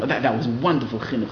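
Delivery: usual speaking voice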